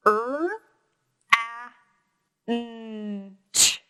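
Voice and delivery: Er, a, n, ch said one at a time; sounds pulled apart. Shown as a demonstration. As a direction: segment ranch sound by sound. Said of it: "let's pull that apart".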